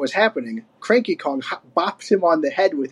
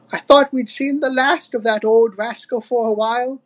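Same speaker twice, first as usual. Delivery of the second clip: put-on higher-pitched old wife voice; Wrinkly Kong, sighing; 7 semitones higher than usual. A narrator's reading. Old wife on hearing the ransom note, sighing. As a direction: sigh it in a raised, elderly character voice. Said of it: "sighed Wrinkly Kong".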